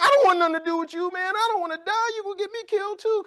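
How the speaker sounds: high whiny mock panic; panicked sidekick. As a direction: squeal like a terrified comedic sidekick. Scared sidekick refusing to help.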